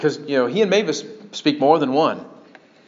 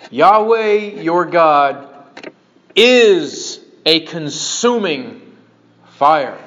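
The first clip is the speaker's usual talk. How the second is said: very slow, emphatic declaration; weighty scripture quote; 3 dB louder, much slower than usual. Acted. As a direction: space out each phrase and land it firmly.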